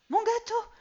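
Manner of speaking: very high, loud cry of dismay; suddenly remember the oven; mother crying out about her cake; sudden alarm. Acted.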